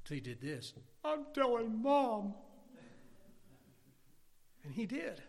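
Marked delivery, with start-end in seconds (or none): 1.0-2.3 high, drawn-out tattletale whine; mock tattling. Brother threatens to tell Mom, drawn-out tattling. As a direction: draw it out like a child telling on someone.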